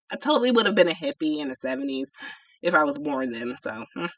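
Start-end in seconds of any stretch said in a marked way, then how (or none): none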